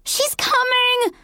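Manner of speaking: high, drawn-out panicked warning; hysterical scream. A narrator's reading. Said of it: "Rosemary screamed hysterically".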